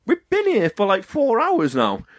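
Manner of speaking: high-pitched mock complaint; retold indignant protest. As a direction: protest high, drawing out the end. Waiting man's retold protest.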